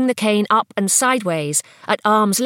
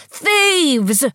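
high, drawn-out cry falling in pitch; old man raising the alarm with great force; ringing cry; 9 semitones higher, 4 dB louder than usual. A narrator's reading. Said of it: "his voice ringing out".

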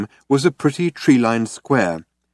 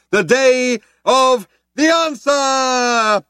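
very high, drawn-out shout; cheerleader's cry; 13 semitones higher, 3 dB louder, much slower than usual. Acted. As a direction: proclaim each word slowly to a crowd. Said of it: "cried the cheerleader".

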